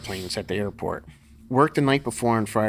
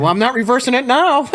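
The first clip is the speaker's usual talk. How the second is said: higher, louder, smug refusal; stubborn judge; 12 semitones higher, 7 dB louder than usual. Retold or imitated. Judge refusing to reverse the call, stubborn and smug. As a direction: refuse flatly to back down. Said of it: "the one judge said".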